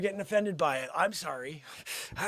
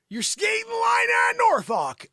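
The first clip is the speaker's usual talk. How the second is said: warning shout; 13 semitones higher, 4 dB louder than usual. Acted. Warning someone they are skating the line, high shout. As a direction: stretch the warning out high and loud. Mock warning about offensive word.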